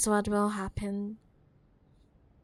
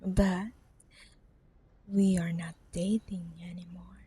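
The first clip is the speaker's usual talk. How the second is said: whisper; 3 semitones lower, 5 dB quieter than usual. Acted. Cold reminder to the ex.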